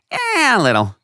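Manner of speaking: reluctant verdict. Retold or imitated. Imitating a frank friend judging the show's length, high and reluctant. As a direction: hedge it in a high, squeezed voice.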